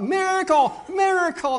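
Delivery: high, loud shout of miracle; crying out. Retold or imitated. Pastor shouting out as the blade stops, loud and high. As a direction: shout it out in awe. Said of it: "and he cries out".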